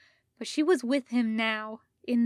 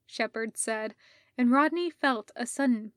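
ordinary delivery, nothing marked

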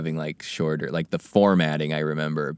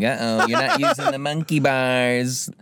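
schoolkid taunt; 5 semitones higher than usual. Pretend. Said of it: "elementary school insult".

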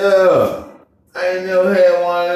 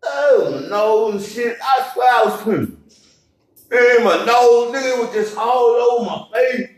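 excited retelling; 6 semitones higher than usual. Retold or imitated. Retelling a shocking surprise.

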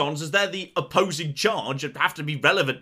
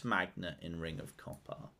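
quiet echo; 12 semitones lower, 19 dB quieter than usual. Real, not acted. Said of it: "Ah, fuck".

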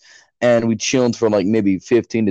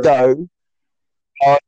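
mock British accent; 3 semitones higher than usual. Shown as a demonstration. Showing friend how British would sound, mock British accent. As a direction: caricature British-Australian command. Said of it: "That's a British".

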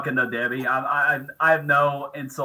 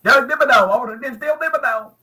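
Doug Dimmadome impression; 7 semitones higher than usual. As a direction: ham it up like the cartoon tycoon. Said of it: "[name] about to be Doug Dimmadome".

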